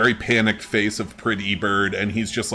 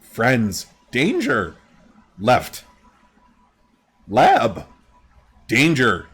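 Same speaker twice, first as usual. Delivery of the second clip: bird creature; 3 dB louder, much slower than usual. Acted. Voices Pretty Bird.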